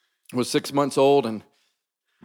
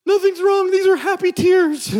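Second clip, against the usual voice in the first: very high, loud squeaky imitation of tearful mom; hammed-up happy-tears wail, way up in pitch; mock crying in a mother's high voice; bawling mom; 5 dB louder than usual. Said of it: "bawling her eyes out".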